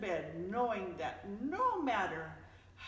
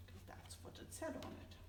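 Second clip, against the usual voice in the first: whispered aside; 8 semitones higher, 13 dB quieter, faster than usual. Real, not acted. Aside to listeners.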